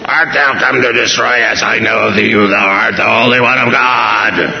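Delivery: sustained, chant-like demonic cry; screaming demon. Acted. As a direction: howl it out as an unclean spirit, no pauses. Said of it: "this was a demon screaming".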